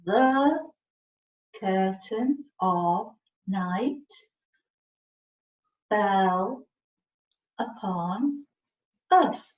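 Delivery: each word slowly, with a pause between; slow pointed reading for a child. Shown as a demonstration. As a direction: read one word at a time as the finger points. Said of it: "Let's follow it with your finger".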